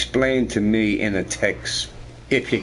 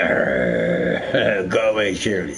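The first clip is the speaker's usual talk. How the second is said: low mock growl, lightly angry; 6 semitones lower, slower than usual. Retelling his partner shooing the dog, mock angry. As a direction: imitate a grumpy growl, pitched down. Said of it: "she used to get angry".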